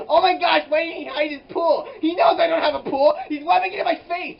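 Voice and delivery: high, loud mock outrage; hammed-up indignant squeal; outraged shriek. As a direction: shriek it like a wounded diva. Mock outrage at neighbor's pool.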